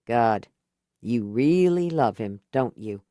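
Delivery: lightly voiced man. A narrator's reading. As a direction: drop a little into Whitney's voice. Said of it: "Whitney, lower male voice".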